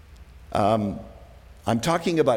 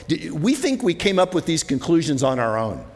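higher, louder fervent preaching; preaching at full force; 8 semitones higher, 4 dB louder than usual. Acted. Pastor exhorting the congregation, loud fervent preaching. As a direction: drive it home to the congregation.

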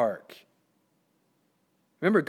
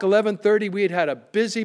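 ordinary talk

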